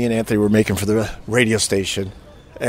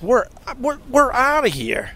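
high-pitched, stammered exit line; mock-panicked and high; retold alarm; 13 semitones higher than usual. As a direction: bail out in a high, rattled voice. Retold urge to leave at once.

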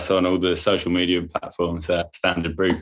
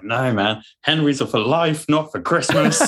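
mock earnest slogan; 8 semitones higher than usual. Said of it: "And I was like".

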